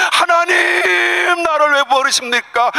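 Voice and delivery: Jesus wailing to God on the cross; anguished cry. Acted. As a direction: wail it out in anguish. Jesus's cry, with crying shout.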